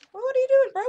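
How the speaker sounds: high, loud startled cry; startled yelp. Real, not acted. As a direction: blurt it out in shock. Startled at teammate.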